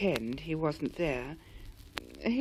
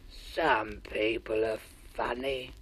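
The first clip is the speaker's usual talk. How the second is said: lower, slow sighing creature; low squamp musing; 8 semitones lower, 4 dB quieter, slower than usual. A narrator's reading. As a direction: muse sadly to himself. Squamp muses sadly.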